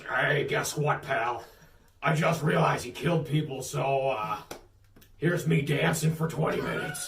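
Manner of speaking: lightly put-on showman voice; Vince McMahon. Promoter filling forty-five minutes alone, mildly hammed-up showman. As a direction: stall an empty arena with a hint of swagger. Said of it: "If you're Vince McMahon".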